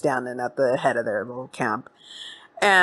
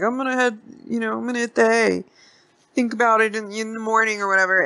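put-on high-pitched, hesitant old man; old dude; 7 semitones higher, faster than usual. Old dude playing along with the stranger, high hesitant voice. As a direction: play the old man hedging in a raised voice. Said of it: "the old dude's like".

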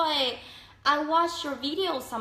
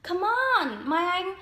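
coaxing; 6 semitones higher, 3 dB louder than usual. Real, not acted. Coaxing viewer to use English.

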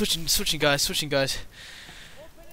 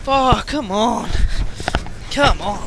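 frustrated; 3 semitones higher, 6 dB louder, slower than usual. Real, not acted. Frustrated at game.

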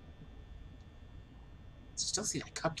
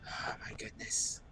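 whispered; 2 semitones lower, 7 dB quieter than usual. Real, not acted.

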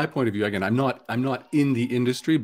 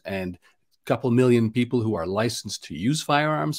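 usual speaking voice

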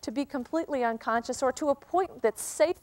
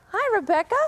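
higher, louder sweet hello to a wolf; high-pitched greeting; 7 semitones higher, 4 dB louder than usual. Real, not acted. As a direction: greet her up high like a wolf's whine. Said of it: "my voice is raised in pitch".

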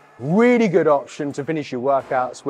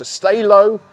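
loud, higher coaching call; coaching cue; 6 semitones higher, 8 dB louder than usual. Shown as a demonstration. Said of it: "we're really gonna emphasize".

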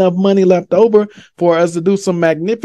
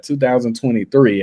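normal talking voice

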